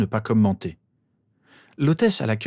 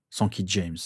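ordinary delivery, nothing marked

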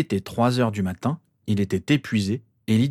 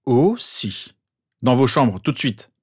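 higher, louder firm command; stern order; 3 semitones higher, 4 dB louder, slower than usual. A narrator's reading. Father sends protesting boys to their rooms, sternly commanding. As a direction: order them off, brooking no argument.

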